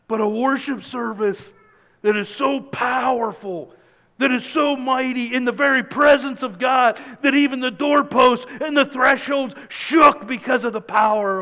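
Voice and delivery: fervent preaching climax; preaching at full force. Acted. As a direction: lift the room with mounting awe. Builds to a worship climax.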